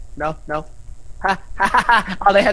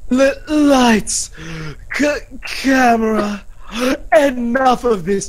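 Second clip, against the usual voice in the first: theatrical challenge, higher at first, then settling down; dramatic game character; 5 semitones higher than usual. Acted. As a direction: open high and declaiming, then drop into scorn. Performs game character's dramatic speech.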